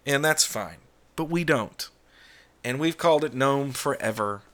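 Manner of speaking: ordinary delivery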